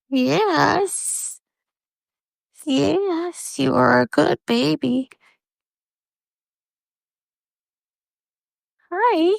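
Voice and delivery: sweet high coo to a good kitty; cooing pet talk. Real, not acted. Praising cat in baby talk.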